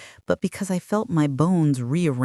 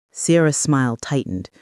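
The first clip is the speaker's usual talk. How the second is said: usual speaking voice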